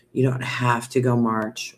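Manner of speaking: usual speaking voice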